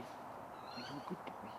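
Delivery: slow soft whisper to the bird; tender whisper. Real, not acted. Falconer whispering to his gentle raven, slow tender whisper. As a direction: whisper it lovingly, slowly.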